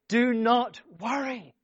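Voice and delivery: high, loud, slow emphatic command; trumpet-blast command. Acted. Preacher thundering Jesus's command at the congregation. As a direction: hammer each word slowly. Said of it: "it's like getting a trumpet blast".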